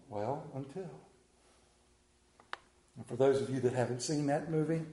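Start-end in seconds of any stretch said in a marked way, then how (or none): 0.1-0.9 much softer and lower "Well, until", rising; soft low answer "Well, until". Acted. God answering his children, soft low "Well, until". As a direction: answer gently, "Well, until" like a patient father.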